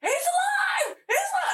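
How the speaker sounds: very high-pitched mock shout; acted excited cry. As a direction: squeal the news like an overjoyed boy. Acting out boy's excited cry.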